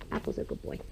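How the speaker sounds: whispered praise to a horse; whispering. Real, not acted. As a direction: whisper softly to the animal. Petting the horse in the stall.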